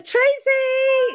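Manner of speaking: very high, drawn-out welcome; excited greeting. Real, not acted. Host welcoming a friend onto the call, excited high-pitched greeting. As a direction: squeal the name as she arrives. Greets Tracy as she joins.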